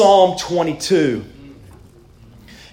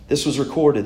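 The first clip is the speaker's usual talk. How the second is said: normal talking voice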